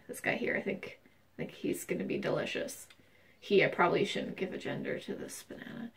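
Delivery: soft whispered secret to the camera. Pretend. Whispering to viewers about her favorite banana.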